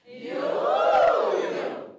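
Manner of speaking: high loud arcing swoop; round the world yo-yo swoop. Shown as a demonstration. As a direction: loop the voice round in a big arc. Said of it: "One more, more?".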